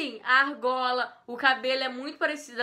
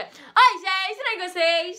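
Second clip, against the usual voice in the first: "Oi" blasted much higher and louder, then "gente, tudo bem com vocês?" still loud and high; loud YouTuber intonation; 6 semitones higher, 8 dB louder, slower than usual. Shown as a demonstration. Showing viewers the right intro, loud and high. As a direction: greet so loud it deafens people. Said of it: "Tem que ter um negócio da entonação".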